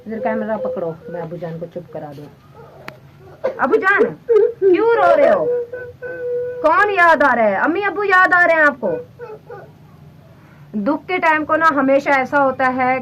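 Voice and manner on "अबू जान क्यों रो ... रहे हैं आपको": tearful pleading. Real, not acted. Daughter comforting crying father.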